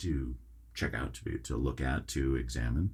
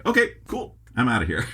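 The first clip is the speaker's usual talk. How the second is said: excited; 8 semitones higher, 8 dB louder, faster than usual. Retold or imitated. Himself leaving the store.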